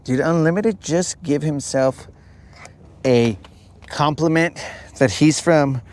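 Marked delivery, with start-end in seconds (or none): none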